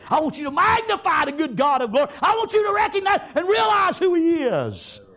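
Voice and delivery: high, loud, fervent command to praise; rousing shout. Retold or imitated. Joshua urging the people to shout praises, loud and high. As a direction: lift them up with a full shout.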